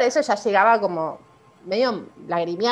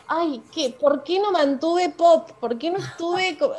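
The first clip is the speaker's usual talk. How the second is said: self-reproach; 7 semitones higher, slower than usual. Retold or imitated. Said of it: "me empezaba como a reprochar".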